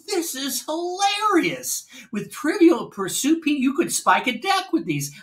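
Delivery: high, slow, tickled quote; delighted, amused. Retold or imitated. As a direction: gush like he can't stop laughing. Vic laughing at the game.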